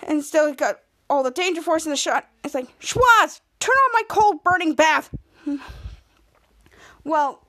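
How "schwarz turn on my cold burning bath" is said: high, raised calling voice; hammed-up demand, louder on the name; mock bossy shout. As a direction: call out the order like an imitated boss. Imitates boss calling out an order.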